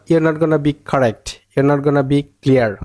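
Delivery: ordinary talk, nothing marked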